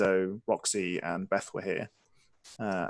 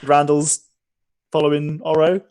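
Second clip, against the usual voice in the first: ordinary talk, nothing marked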